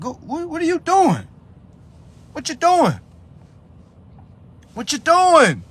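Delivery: high, loud exasperated challenge; aggressive yelling. Shown as a demonstration. Pushing listeners to enforce what they know, aggressive high shouting. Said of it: "they don't like when I be talking like this".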